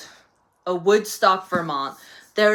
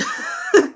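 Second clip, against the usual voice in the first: high burst of laughter; 5 dB louder than usual. Real, not acted. Laugh after teasing readers.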